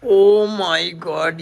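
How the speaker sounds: loud, long-stretched exclamation; drawn-out amazement. Real, not acted. Uncle bargaining with child over food, drawn-out loud amazement.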